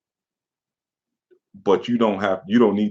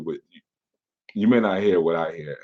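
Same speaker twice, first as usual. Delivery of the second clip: normal talking voice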